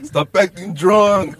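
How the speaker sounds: slow drawn-out scold; mimicking friend. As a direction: say it slowly like a fed-up friend. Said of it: "Just the way he said it".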